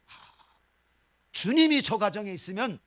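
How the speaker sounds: ordinary talk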